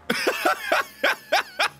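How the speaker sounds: very high squealing laugh; laughter. Real, not acted. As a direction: burst out in high cackles. Laughing at the game.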